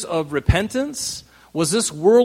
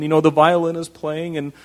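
usual speaking voice

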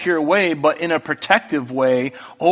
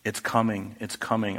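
ominous warning; 8 semitones lower, 9 dB quieter than usual. Acted.

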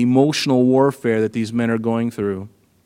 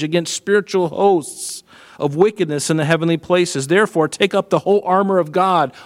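raised, ringing scripture reading; preaching at full force; 4 semitones higher than usual. Acted. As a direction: proclaim the armor of God.

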